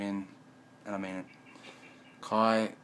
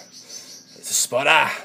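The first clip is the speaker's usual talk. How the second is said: slow, much louder, higher burst; excited exclaim; 6 semitones higher, 13 dB louder, much slower than usual. Real, not acted. Telling viewers about spotting, loud slow excited shout. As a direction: shout it out, savouring each word.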